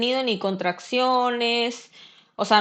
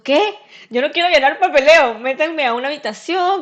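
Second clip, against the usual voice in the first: retold protest; 5 semitones higher, 5 dB louder than usual. Protesting to hospital staff.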